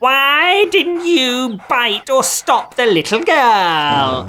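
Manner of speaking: high, slow furious witch question; angry witch. A narrator's reading. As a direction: demand it like a raging witch. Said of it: "ran to the dogs".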